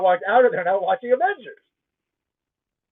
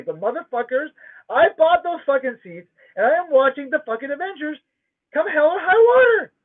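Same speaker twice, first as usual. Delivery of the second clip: indignant rising shout; defiant rant; 7 semitones higher than usual. Real, not acted. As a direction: vow it like nothing will stop you. Ranting about keeping his seat.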